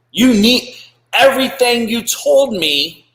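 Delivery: a high, loud, slow appeal; forceful push. Acted. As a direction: pound the point into a scared recruit. Pushing a scared recruit.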